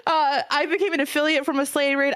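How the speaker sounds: high, teary voice fighting off crying; thanking the streamer who made her, on the edge of tears; holding back tears. Real, not acted. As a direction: voice cracking as tears come while thanking a friend. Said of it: "I'm trying not to cry".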